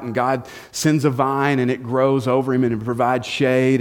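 ordinary talk, nothing marked

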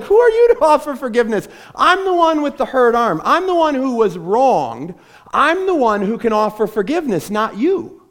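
wronged friend, fired up and fast; indignant protest. Acted. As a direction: protest like the one who got punched. Hurt friend confronts bystander.

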